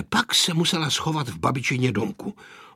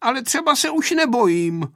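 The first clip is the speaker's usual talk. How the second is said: high-pitched boyish admission, lightly voiced; lightly voiced child's grumble; muttering boy; 10 semitones higher, 3 dB louder than usual. A narrator's reading. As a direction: own up to fear, then rally. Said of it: "hučel Pepíček".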